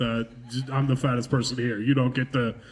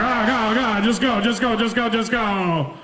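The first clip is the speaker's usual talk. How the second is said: high, loud frantic urging; urgent pleading; 7 semitones higher, 3 dB louder than usual. Retold or imitated. Comic panic at a swallowed spider, urging it down. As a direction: beg it to go down, fast and high.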